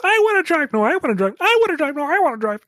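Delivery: high, loud bickering back and forth; squabbling robots. Acted. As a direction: fight over the wheel like kids. Robots bickering over driving.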